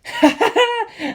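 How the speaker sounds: high burst of laughter; bright high laugh; laughing out loud. A narrator's reading. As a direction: laugh out in delight. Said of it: "I couldn't help but laugh out loud".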